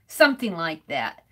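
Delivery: normal talking voice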